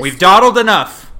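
high, loud show-host call; announcer voice. Pretend. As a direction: boom it out like a host. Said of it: "ready for real podcast time now".

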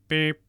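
single high vocal beep; short, high beep by mouth; imitated phone beep. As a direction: mimic a phone system tone.

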